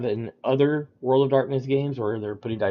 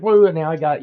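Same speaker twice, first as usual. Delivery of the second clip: mildly excited "woo", a little higher and louder; excited player; 5 semitones higher, 6 dB louder, faster than usual. Retold or imitated. Players cheering their rolled tens at the table, mock excitement. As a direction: lightly imitate a player thrilled by a lucky roll. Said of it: "they got excited".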